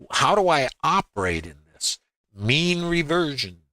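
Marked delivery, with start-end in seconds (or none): 2.3-3.6 "Mean" held high and drawn out; stress on MEAN. Shown as a demonstration. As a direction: lean on the word "Mean". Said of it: "stressing the word mean".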